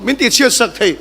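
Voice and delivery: ordinary delivery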